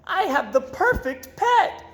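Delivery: high, bubbly little fish voice; happy goldfish voice. Acted. Goldfish Gilbert delighted with his new dog. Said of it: "Gilbert glubbed".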